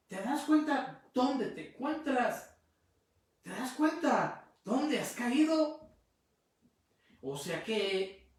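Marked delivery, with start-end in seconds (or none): 0.1-5.9 higher, louder, slow pleading; a mother begging her lost son to see where he is. Retold or imitated. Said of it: "y le dice". (súplica lenta y aguda de madre)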